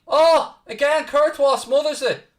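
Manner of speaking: high, loud gasp into the call; sudden thrill as the keeper saves; excited shout. Real, not acted. Commentator shouts as Courtois smothers the ball, excited. Live commentary, near goal.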